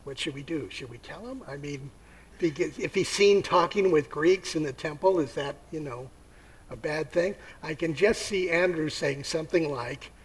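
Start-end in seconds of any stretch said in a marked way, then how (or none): 0.1-7.3 worried whisper. Acted. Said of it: "And he goes".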